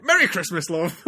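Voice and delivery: loud, high, hammed-up cheery greeting; mock pizzazz. Shown as a demonstration. Reader joking about how they'd say it, hammed-up pizzazz. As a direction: belt it out as a jokey festive greeting. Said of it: "too much kind of pizzazz".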